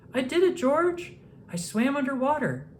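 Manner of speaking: put-on high, bright, thrilled girl voice; Lucy cheering her success; excited little girl. A narrator's reading. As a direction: burst out with proud joy. Lucy's triumph.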